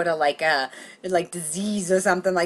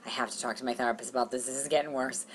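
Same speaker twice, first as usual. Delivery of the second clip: subdued resolve; 6 semitones lower, 10 dB quieter than usual. Retold or imitated. Resolving after crying for hours.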